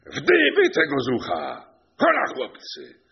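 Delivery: high, loud order to servants; shouted command. A narrator's reading. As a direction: call the lads to seize him. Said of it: "sędzia krzyknął".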